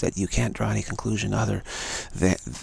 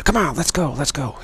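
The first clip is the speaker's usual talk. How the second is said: higher-pitched, insistent urging; urging man; 5 semitones higher than usual. Acted. Man urging the frightened walker onward, insistent. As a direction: push them to get moving. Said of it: "the man saying".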